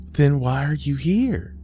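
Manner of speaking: high, searching question; puzzled daughter. A narrator's reading. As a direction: ask your mother, bewildered, voice lifted. Daughter questions her mother's ghost.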